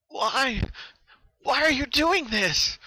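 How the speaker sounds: high, desperate pleading cry; pained frantic cry. Acted. Rainbow Dash pleading with her torturer, pained frantic cry. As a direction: cry out in pain and panic. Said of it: "the pained and frantic cry of Rainbow Dash".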